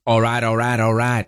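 put-on half-hearted stab at a Southern American accent; Matthew McConaughey accent. Radio host trying the movie star's catchphrase, poor Southern accent. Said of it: "I can't do his accent".

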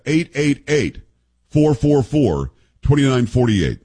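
slow, separated digits in groups of three; deliberate dictation pace for a phone number. Shown as a demonstration. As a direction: read the number out slowly, group by group. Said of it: "give you that number again and slower".

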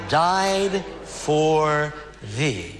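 very slow, drawn-out lament; weeping king's lament. Acted. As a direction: stretch each word in understated grief. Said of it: "as he wept, he said".

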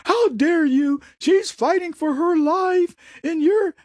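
high, indignant how-dare-you; mock offended outrage. Mocking a fraud's fans, high indignant outrage.

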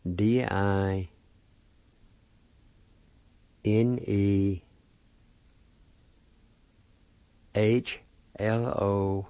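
letters in small groups, long gaps between; spelled out slowly. Shown as a demonstration. Teacher spelling the word for play, slowly letter by letter. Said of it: "it's spelled like this".

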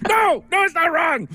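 very high comic shout; mock-frantic falsetto denial; mock protest. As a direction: squeal it out in exaggerated panic. Host repeats comic panicked denial.

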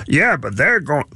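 a hint of a dopey hayseed voice; lightly voiced goofy yokel; mock dim-witted sidekick; Mortimer Snerd voice. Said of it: "Oh, Mortimer Snerd".

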